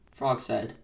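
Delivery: ordinary delivery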